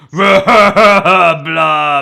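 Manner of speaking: slow, loud laughing blob voice; blob monster voice. Retold or imitated. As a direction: blurt it out like a goo monster, cracking up. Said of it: "I'm trying to do an impression of the blob voice".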